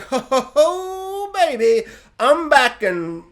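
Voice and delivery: high, slow, crying-out mock Homelander; drawn-out high triumphant crow; Homelander gloat. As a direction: stretch it out like a smug returning hero. Homelander gloating over his cup.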